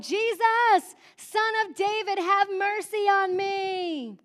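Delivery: high, drawn-out desperate call; crying out. Acted. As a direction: cry out to Jesus over a crowd. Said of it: "He cried out".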